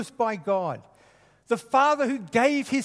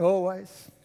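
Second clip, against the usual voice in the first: "Always" drawn out with a broad Queensland Australian vowel, lower and slower; Queensland Australian accent; 5 semitones lower than usual. Shown as a demonstration. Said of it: "You know what always means in Queensland?".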